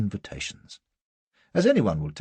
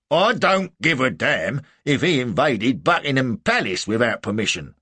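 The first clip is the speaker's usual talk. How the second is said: loud, raised soldier's voice; blustering sergeant; 6 semitones higher, 7 dB louder than usual. A narrator's reading. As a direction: brush off the complaint like a sergeant. Sergeant dismisses angry complaint.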